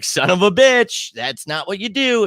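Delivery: a drawn-out, exasperated curse at the team; frustrated outburst. Retold or imitated. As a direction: vent like a fan watching a blown drive. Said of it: "I literally was like".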